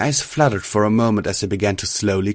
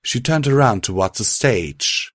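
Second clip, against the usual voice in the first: usual speaking voice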